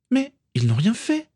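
petite fille qui proteste, voix très aiguë; protestation de fillette. A narrator's reading. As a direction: plaide d'une voix haut perchée d'enfant. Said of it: "protesta Morgan". (petite sœur protestant, ton très aigu)

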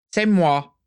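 proud declaration, high and loud; loud, high, self-proud claim. A narrator's reading. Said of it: "déclara fièrement Abraxas Malfoy".

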